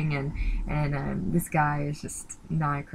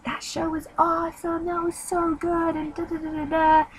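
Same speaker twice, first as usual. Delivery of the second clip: much higher, gushing mock-enthusiastic man; excited guy; 13 semitones higher than usual. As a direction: ham up a thrilled boyfriend raving about the show. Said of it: "the guy was like".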